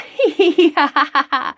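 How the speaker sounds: high, loud cackling laugh; hyena cackle. Acted. Reader imitating a hyena for the story, high and loud.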